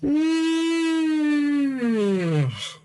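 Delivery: long high yawning sigh, falling away; a huge sleepy yawn that slides down; big yawn. Real, not acted. Host yawning for the third time on the show, long yawn. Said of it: "That's my third yawn".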